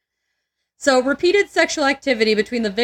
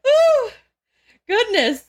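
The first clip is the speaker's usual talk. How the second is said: breathless squeal; 12 semitones higher, 3 dB louder, slower than usual. Real, not acted. Recovering from a laughing fit.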